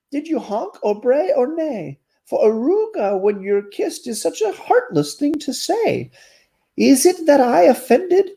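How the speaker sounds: a heartbroken turtle begging answers; storybook turtle, hurt and pleading. Acted.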